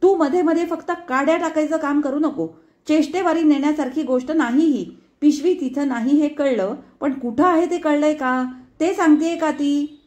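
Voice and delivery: high, loud rebuke to husband; wife yelling at husband; angry shout. A narrator's reading. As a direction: shout him down. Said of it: "ओरडली".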